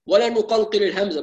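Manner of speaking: normal talking voice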